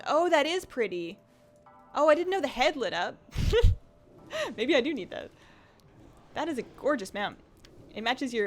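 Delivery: ordinary talk, nothing marked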